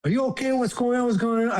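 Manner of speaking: like you think somebody died; alarmed concern. Retold or imitated. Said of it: "He's like".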